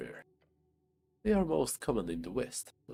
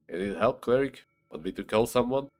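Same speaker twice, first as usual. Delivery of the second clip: louder, mock-helpful calling out; teasing call; 2 semitones higher, 9 dB louder than usual. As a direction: call across the room to rib him. Calling out to the injured.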